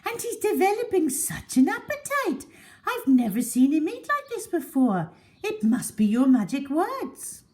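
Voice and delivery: high, loud doting lady's voice; gushing high-pitched pet talk; Mrs Silver cooing. A narrator's reading. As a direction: coo at her pet like a doting owner. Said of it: "Missus Silver said".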